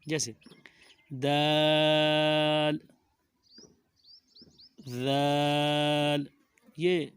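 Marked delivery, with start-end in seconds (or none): 1.2-2.8 "دال" very slow and loud, a held on; long madd. Shown as a demonstration. Teacher demonstrates longer holding, sustained "دال". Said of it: "ان کو زیادہ دیر لگائیں گے". 4.9-6.2 "ذال" very slow and loud, starting with th as in "this"; long madd. Shown as a demonstration. Teacher demonstrates longer holding, sustained "ذال". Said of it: "ان کو زیادہ دیر لگائیں گے".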